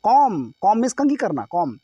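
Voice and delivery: ordinary delivery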